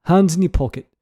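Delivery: hammed-up British scolding; theatrical telling-off in a fake British accent; bad British accent. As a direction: put on an unconvincing British accent and scold them. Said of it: "how bad [name]'s accent was".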